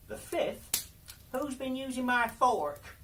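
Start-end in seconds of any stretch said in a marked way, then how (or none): 1.3-3.0 higher, a bit louder dwarf; little dwarf voice. Acted. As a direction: play a small fussy dwarf, pitched up. Fifth dwarf asks.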